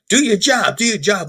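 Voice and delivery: high-pitched mock yelling; constituents yelling. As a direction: shout it like fed-up voters. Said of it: "they're being yelled at by".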